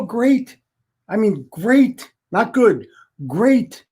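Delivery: "great" stretched high with a lift; stress on great. Real, not acted. Telling listeners how helping others feels, emphatic high "great". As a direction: punch each "great" high, drawing it out. Insists great, not merely good.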